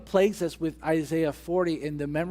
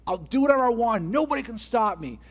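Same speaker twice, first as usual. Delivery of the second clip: higher, louder mock arrogance; imitating a cocky boast; louder swaggering defiance; arrogant boast; 7 semitones higher, 4 dB louder than usual. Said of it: "That's a statement of arrogance".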